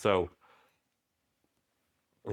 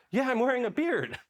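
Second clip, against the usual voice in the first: much higher and louder, stating the obvious; high, emphatic, mock exasperation; duh; 4 dB louder than usual. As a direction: point out the obvious, amused.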